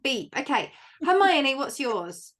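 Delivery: ordinary delivery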